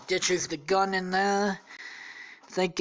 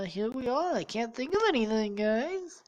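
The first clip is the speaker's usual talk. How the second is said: voice going odd; 7 semitones higher than usual. Real, not acted. Podcaster out of ideas to listeners, voice oddly high. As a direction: let the voice slide up high. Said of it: "what the fuck is wrong with my voice".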